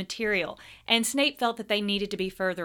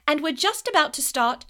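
high, loud, eager schoolgirl; eager Hermione; 7 semitones higher, 7 dB louder than usual. A narrator's reading. Hermione rushing to answer the teacher, high eager voice. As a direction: blurt it out eagerly, pitched up. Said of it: "said Hermione quickly".

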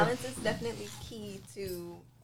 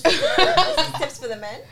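laughing; 8 semitones higher, 15 dB louder than usual. Real, not acted. Laughing disbelief at the tips.

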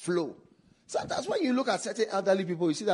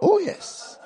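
congregation response; 6 dB louder than usual. Real, not acted. Congregation call-back.

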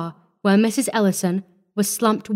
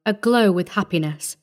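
ordinary delivery, nothing marked

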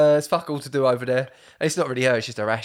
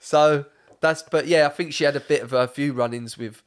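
usual speaking voice

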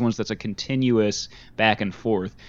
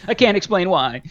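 normal talking voice

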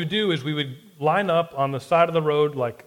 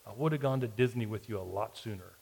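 softer and lower, said up close; leaned-in quiet line; 4 semitones lower, 8 dB quieter than usual. Retold or imitated. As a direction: lean in and say it softly, a final sting. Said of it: "She leaned in close and said".

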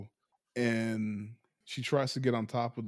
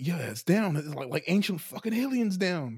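excited Angel; 6 semitones higher than usual. Retold or imitated. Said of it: "And he's like".

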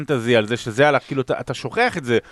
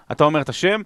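ordinary talk

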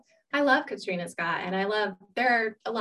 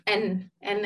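ordinary talk

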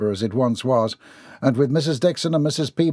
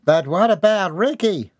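high voice calling from the back; child in back seat; 8 semitones higher, 3 dB louder than usual. A narrator's reading. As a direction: pipe up with a worried question. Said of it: "Percy's voice resonated from the back seat".

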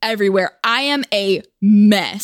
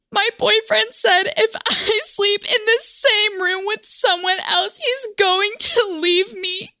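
high, whiny put-on voice of a frantic girl; mock distraught girlfriend; 10 semitones higher than usual. To a stranger, faking despair in a high whine. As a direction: lay on the lovesick panic, pitched way up.

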